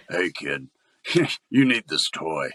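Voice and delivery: mock a slightly creepy adult selling toys; creepy adult pitchman. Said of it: "that kinda creeps them out".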